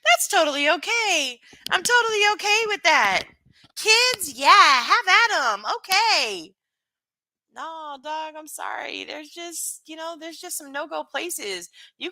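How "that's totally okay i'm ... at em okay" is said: high, sing-song mock of a dismissive reply. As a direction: parrot them in a much higher, sarcastic voice. Mocking someone's dismissive reply.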